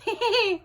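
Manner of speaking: high short giggle; light giggle. Acted. Samantha giggling at Sam, a high short laugh. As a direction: let a giggle out. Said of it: "laughed Samantha".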